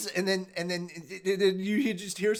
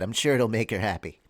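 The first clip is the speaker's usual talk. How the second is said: sarcastic; 10 semitones lower than usual. Acted. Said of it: "Way to lay on the sarcasm".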